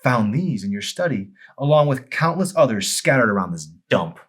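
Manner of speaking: accusing wife. Acted. Amy exposing bottles.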